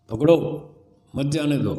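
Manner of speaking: पकड़ो alone, pause, then मत जाने दो together; pause after पकड़ो. Shown as a demonstration. Jailer's order to catch him, pause after पकड़ो. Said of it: "इधर उधर करने से अर्थ बदलता है".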